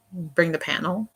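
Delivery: ordinary talk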